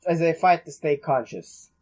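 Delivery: usual speaking voice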